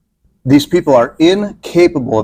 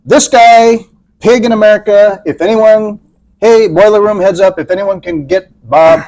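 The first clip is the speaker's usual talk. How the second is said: scammer rallying the room; 6 semitones higher than usual. Acted. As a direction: alert the boiler room like a hyped scammer. Acting a scammer alerting boiler room.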